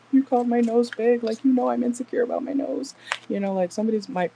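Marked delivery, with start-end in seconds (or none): 0.1-2.9 higher and louder wounded whine; a lightly voiced hurt girlfriend, pitched up; hurt, insecure complaint. Complaining to a teasing lover, hurt and pitched up.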